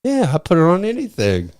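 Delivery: high, loud put-on boast; imitating Vel. Quoting Vel bragging to friend, high mock voice. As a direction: squeak it like the proud coworker. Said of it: "He goes".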